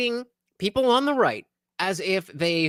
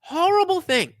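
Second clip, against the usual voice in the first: mock outrage; 10 semitones higher, 4 dB louder than usual.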